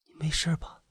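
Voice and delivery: very soft, anxious question; hushed, featherweight wife's voice; feather-light. A narrator's reading. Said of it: "李早的声音轻得像一片羽毛".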